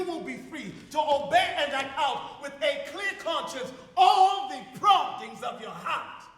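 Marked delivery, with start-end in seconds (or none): 4.0-6.2 rising fervour. Acted. Rising to a climax.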